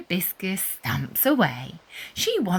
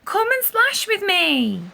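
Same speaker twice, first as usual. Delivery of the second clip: eager invitation; 9 semitones higher, 4 dB louder than usual. A narrator's reading. Anna invites cousin to play.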